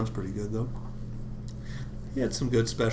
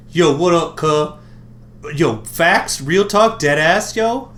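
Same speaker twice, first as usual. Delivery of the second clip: hype street voice; 5 semitones higher, 7 dB louder, slower than usual. Pretend.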